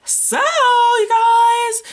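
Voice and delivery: elongated high hey-you-guys; drawn-out call. Acted. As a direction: stretch it out high to grab attention.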